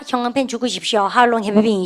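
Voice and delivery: normal talking voice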